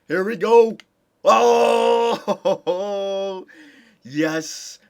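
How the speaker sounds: high, loud oh, breaking into a laugh; thrilled cry. Real, not acted. Gamer watching his team clinch the series, loud thrilled cry. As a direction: cry out in triumph, then laugh.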